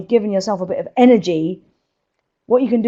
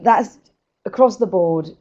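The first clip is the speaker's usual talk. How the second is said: usual speaking voice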